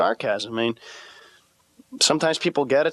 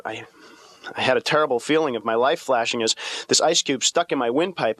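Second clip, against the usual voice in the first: normal talking voice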